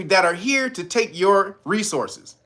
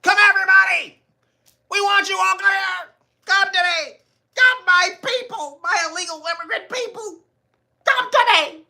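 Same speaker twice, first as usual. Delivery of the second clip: very high, calling out summoning everyone; mock welcoming call; 12 semitones higher than usual. Streamer mocks the mayor calling immigrants in, very high and sing-song. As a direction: summon them like a pied piper. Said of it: "Eric Adams was like".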